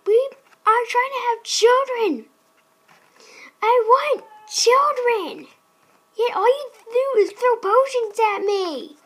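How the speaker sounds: loud, hammed-up marital outrage; mock furious shouting. Husband scolding wife for throwing potions, mock fury. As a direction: bellow at her in overblown indignation.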